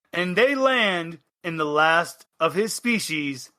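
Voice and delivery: ordinary delivery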